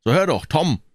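high, insistent call to a friend. A narrator's reading. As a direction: call out to get his attention. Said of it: "Endlich ruft Sid".

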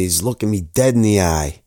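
ordinary talk

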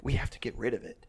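put-on hushed, menacing tripping teen; ominous low. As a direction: say it like a grim verdict. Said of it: "she's like".

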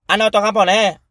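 high, put-on voice of a Chinese waitress; lightly mocking a waitress's high voice. Friend imitating the waitress in a high voice.

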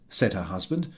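ordinary delivery

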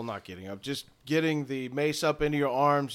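ordinary delivery

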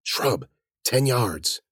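terse stealthy whisper; hushed whisper. A narrator's reading. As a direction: point out danger under your breath. Whispered directions while hiding.

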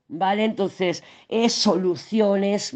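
ordinary delivery

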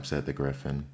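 plain reading voice

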